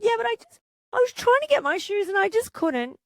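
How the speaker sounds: put-on high, pleading little-kid excuse; child's voice. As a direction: play the child protesting it wasn't their fault.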